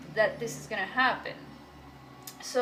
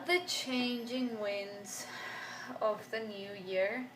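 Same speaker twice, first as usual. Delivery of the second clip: slow dramatic pronouncement; 3 dB quieter, slower than usual. Acted.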